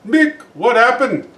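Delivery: slow, high and puzzled; bewildered. Acted. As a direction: ask it dazed and confused. Waking in hospital, bewildered.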